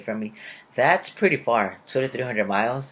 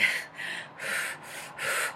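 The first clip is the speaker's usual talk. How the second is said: weary sigh; 11 dB quieter than usual. Retold or imitated. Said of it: "And I was like".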